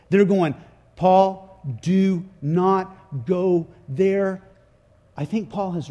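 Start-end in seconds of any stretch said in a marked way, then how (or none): 1.0-4.4 slow, word-by-word plea; acted stern, drawn-out warning; emphatic warning. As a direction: warn him firmly, spacing out each word. Officials acted out warning Paul.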